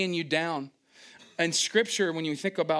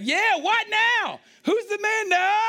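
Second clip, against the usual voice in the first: much higher, louder, slow triumphant crowing; cocky boast; 6 dB louder, slower than usual. Retold or imitated. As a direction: crow it like the star of the game. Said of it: "I'm like".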